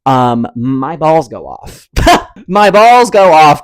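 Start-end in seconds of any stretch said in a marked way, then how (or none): 2.5-3.6 loud, high, drawn-out exclamation. Real, not acted. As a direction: belt it out high and slow. Repeats the line, exclaiming.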